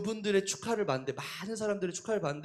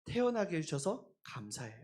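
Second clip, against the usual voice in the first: much softer, sincere thanks to God; grateful prayer; 5 dB quieter than usual. Retold or imitated. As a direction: pray it gently, with real gratitude. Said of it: "고백을 하나님께".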